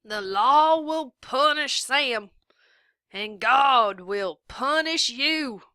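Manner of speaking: sheriff's parting threat. A narrator's reading. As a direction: call it after her as she leaves.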